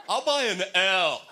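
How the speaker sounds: hammed-up wrong guess; dim contestant. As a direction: announce it like a triumph. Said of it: "Turn to Wheel of Fortune".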